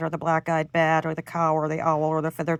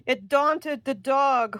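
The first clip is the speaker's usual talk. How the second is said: plain reading voice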